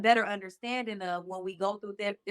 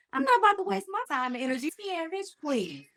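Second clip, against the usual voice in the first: high-pitched, quick brush-off, falling away at the end; sassy dismissal; 6 semitones higher than usual. Retold or imitated. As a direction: act out waving someone off, pitched up. Dismissing an angry partner, acted out.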